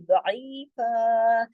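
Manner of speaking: दईफा very slow, ending on a long ा; pausal recitation. Shown as a demonstration. Teacher showing the pause at the end, slow. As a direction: stretch the final ा in दईफा. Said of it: "एक अलिफ पढ़ा जाएगा".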